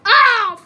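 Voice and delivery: sharp loud ow of pain; pained yelp. Real, not acted. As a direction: yelp as if hurt. Gets hurt.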